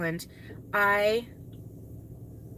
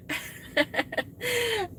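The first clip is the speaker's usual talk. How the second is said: laugh; 11 semitones higher than usual. Real, not acted. Laughing about Lori's videos.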